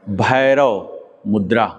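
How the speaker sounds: भैरव मुद्रा drawn out slowly and clearly; slow recap. Shown as a demonstration. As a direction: slowly give the answer so it sticks. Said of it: "ये क्या कहलाया?". (धीमे, साफ़ उच्चारण में)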